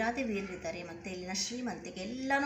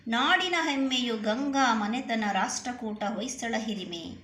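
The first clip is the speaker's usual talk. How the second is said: louder, rhythmic verse reading; poem recitation; 3 semitones higher, 6 dB louder than usual. Acted. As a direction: proclaim the line to the class. Re-reads a poem line before explaining.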